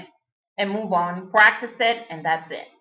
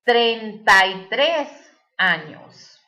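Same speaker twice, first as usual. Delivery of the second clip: slow, carefully spaced Spanish sentence; slow teacher's model answer in Spanish; 4 dB louder, slower than usual. Shown as a demonstration. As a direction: say the sample answer slowly and clearly.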